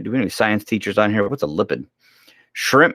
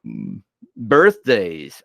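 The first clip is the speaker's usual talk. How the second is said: slow, stretched yawning voice; much slower than usual. Real, not acted. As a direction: say it through a big yawn. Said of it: "I cannot stop yawning".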